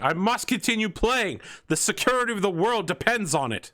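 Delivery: high, insistent chancellor voice; desperate chancellor. Acted. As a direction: plead that the world depends on it. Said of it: "He's like".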